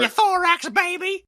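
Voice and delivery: very high, loud silly taunt; mock threatening shout. Player making loud threats to scare a monster, mock shout. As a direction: yell a ridiculous insult in a squawky pitch. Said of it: "I can make loud noises and threats".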